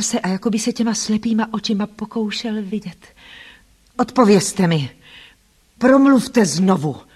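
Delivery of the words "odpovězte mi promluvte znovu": loud, drawn-out lordly order; imperious loud command. A narrator's reading. Blind master orders the unseen speaker to answer, imperious. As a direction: demand an answer with authority. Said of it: "rozkázal pánovitě a hodně nahlas".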